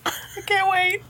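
squeaky, very high mouse voice; tiny mouse voice. Acted. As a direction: squeak like a timid little mouse.

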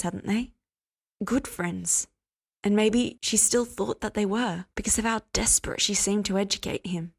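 ordinary delivery, nothing marked

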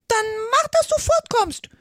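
very high, loud bird dismissal; peevish. A narrator's reading. Pigeon ordering Alice away from its nest, peevish. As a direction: screech a grumpy send-off. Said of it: "sagte die Taube verdrießlich".

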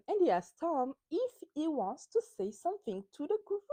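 usual speaking voice

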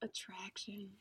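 breathy whispered sultry tease. Pretend. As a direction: whisper it like a perfume ad. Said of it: "That was my worst sultry voice".

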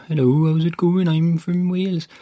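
put-on lilting Welsh accent, pitched a little up. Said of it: "he talks like this, the Welsh do".

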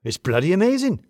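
high, loud relish; delighted amazement. A narrator's reading. Acted delighted reply in dialogue.

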